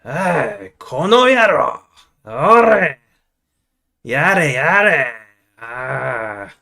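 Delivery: loud, rough-edged Japanese gangster act. Acted. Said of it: "like, a gangster?".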